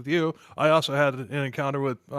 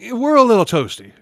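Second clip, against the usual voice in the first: tipsy; 7 semitones higher, 11 dB louder than usual. Real, not acted. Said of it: "we all sound like drunk idiots".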